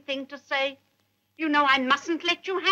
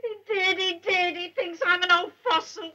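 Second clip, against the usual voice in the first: tearful whimper; 5 semitones higher than usual. Acted. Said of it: "I think I'm going to cry again".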